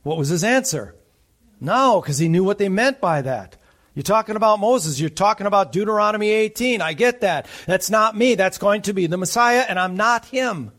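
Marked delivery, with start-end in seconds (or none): 3.9-10.7 higher-pitched, insistent mock protest; acted emphatic denial. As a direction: play John firmly setting questioners straight. John's firm denial acted out by preacher.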